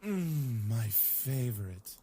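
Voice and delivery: slow, deep savoring purr; suggestive. Acted. Eric greets the waffles, low slow suggestive tone. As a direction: let it sound like an innuendo. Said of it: "I think it's just his tone of voice".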